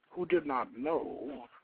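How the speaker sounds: ordinary delivery, nothing marked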